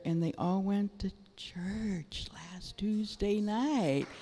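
excited whisper. Acted. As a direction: whisper it like a happy secret. Sharing a delighted secret.

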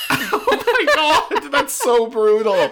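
high, loud, slow disbelief; real stunned laugh-through reaction; gasping at a brutal twist; shocked. Real, not acted.

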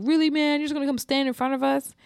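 high, loud indignant complaint; annoyed guy. Retold or imitated. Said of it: "this guy was like".

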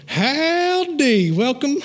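high, drawn-out country howdy; Gomer Pyle. Retold or imitated. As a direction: imitate an over-friendly country bumpkin. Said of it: "He was Gomer Pyle".

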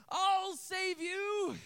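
high, loud, stretched-out hero cry; mock heroic shout. Boy dropping watermelon to rescue girl, mock heroic shout. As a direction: play the boy charging to the rescue. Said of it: "Drops the watermelon".

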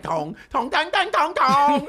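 loud, high mock-Chinese syllables; cartoonish fake Chinese, building high and loud; caricature Chinese accent. Showing the wrong way to do it, caricature Chinese. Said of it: "We're not talking about Bugs Bunny nineteen forty".

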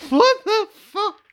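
high, squeaky laughing disbelief. Real, not acted. Cracks up at the failed impression.

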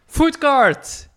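high, loud burst of delight; sudden loud delighted shout; excited exclaim. Real, not acted. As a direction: call it out like you just spotted it. Spotting the food cart.

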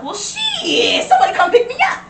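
loud, high-pitched drawn-out holler; retold excited holler. Telling friends how they begged for a lift, excited high holler. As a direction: holler for a ride, laughing through it. Retells urgently calling for a ride.

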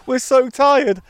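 tipsy and giggling, high and bright. Real, not acted. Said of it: "and I'm a little tipsy".

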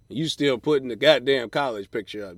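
normal talking voice

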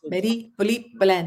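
Marie-Philippe Poulin in a French accent; careful French-accent try. Shown as a demonstration. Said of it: "Have that French accent".

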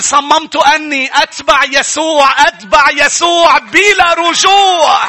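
high, slow, drawn-out shout; screamed declaration. Acted. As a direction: cry it out defiantly, stretching every word. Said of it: "صار يعلن ويصرخ ويقول".

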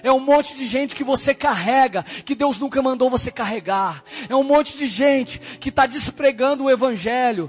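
prophesy with fervor. Retold or imitated. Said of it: "ela falou". (profecia fervorosa e arrastada)

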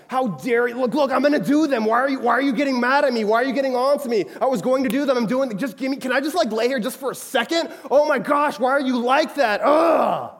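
loud, high, indignant rant, hammed up; exasperated outburst. Roommate cussing out whoever offered to do the dishes, exasperated shouting. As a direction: rant back like a fed-up, cornered roommate.

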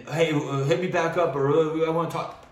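high, loud voice mimicking a needy caller; mocking imitation. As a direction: imitate someone pestering to reconnect. Mocking people trying to reach out.

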